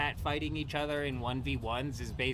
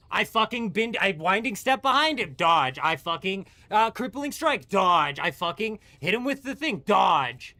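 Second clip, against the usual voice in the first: higher and louder, each dodge spat out in frustration; exasperated rant; 4 semitones higher, 7 dB louder than usual. Real, not acted. Gamer ranting to his friend about the boss dodging, louder and higher. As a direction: vent about every dodge in a rising, heated rant.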